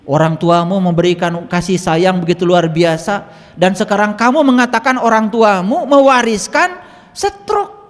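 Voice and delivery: loud, high indignant scolding. Retold or imitated. Healer rebukes patient for blaming parents' genes for stroke, scolding. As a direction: build in outrage, rising to the end. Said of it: "Saya omelin".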